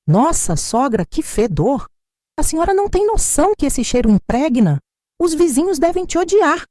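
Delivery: desprezo agudo, nariz torcido; nora com nojo. A narrator's reading. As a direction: humilhe com nojo exagerado. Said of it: "torceu o nariz e disse alto". (nora criticando o cheiro de peixe)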